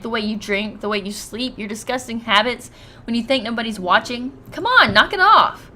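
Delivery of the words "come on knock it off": louder and higher, fed up with the game; exasperated outburst. Real, not acted. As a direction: snap back at the screen, exasperated. Reacting to harsh game insults.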